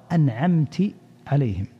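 أنعمت ending in i, "an'amti"; kasra on the ta. Shown as a demonstration. Teacher demonstrating another misreading to listeners, أنعمت said "an'amti". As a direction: say أنعمت with -ti instead of -ta before عليهم. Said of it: "وكذلك لو كسرها فقرأها".

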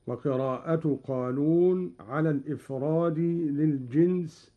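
normal talking voice

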